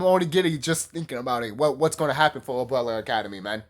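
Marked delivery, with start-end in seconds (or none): none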